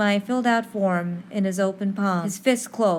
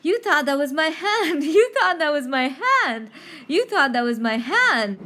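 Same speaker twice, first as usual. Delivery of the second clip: laughing, a bit louder, gleeful; teasing triumph through laughter; excited laughing taunt; 4 semitones higher, 3 dB louder than usual. Retold or imitated. Teller taunts the boy with her hand on his shoulder, laughing. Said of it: "I was so excited".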